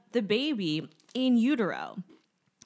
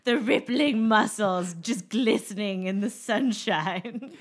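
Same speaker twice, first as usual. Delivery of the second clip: slow, louder, swooning admiration; hammed-up romance novel narration; romance narrator voice; 4 dB louder, slower than usual. Mock romance-novel description.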